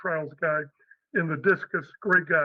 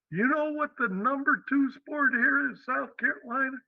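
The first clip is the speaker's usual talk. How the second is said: put-on real Southern accent; 7 semitones higher than usual. Head coach quizzing the new coach, real Southern accent. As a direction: quiz him in a Southern accent. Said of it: "in a real Southern accent".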